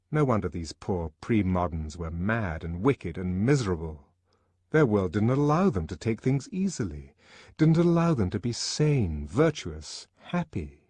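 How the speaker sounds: usual speaking voice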